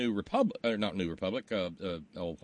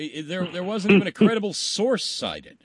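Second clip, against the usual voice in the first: usual speaking voice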